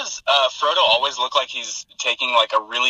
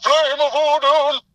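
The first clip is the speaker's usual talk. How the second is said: high, loud, far slower booming wizard; Gandalf; 14 semitones higher, 10 dB louder, much slower than usual. Retold or imitated. Host booming Gandalf's bridge command. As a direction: boom the command out slowly like Gandalf. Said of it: "wow, that was good".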